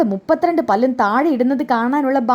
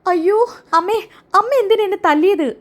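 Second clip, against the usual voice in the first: cry after a slap; 8 semitones higher than usual. Acted. Slapped by her mother-in-law, Chandra wails much higher in pain. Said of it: "മുഖത്ത് ഒരൊറ്റൊരെണ്ണം കൊടുക്കാണ്".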